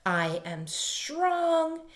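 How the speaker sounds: slow high drawn-out affirmation. Shown as a demonstration. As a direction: stretch each word for them to repeat. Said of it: "repeat after me".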